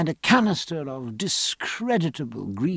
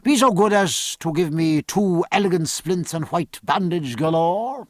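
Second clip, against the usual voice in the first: raised, loud courteous request; calling out; 4 semitones higher, 3 dB louder than usual. Acted. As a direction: call your order to the assistant. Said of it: "he called in the hospital tent".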